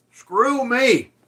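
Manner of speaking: usual speaking voice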